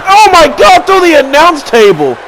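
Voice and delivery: very high, loud scream of disbelief; screamed oh my God; shocked yell. Real, not acted. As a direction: lose it at the table spot. Slam through announce table.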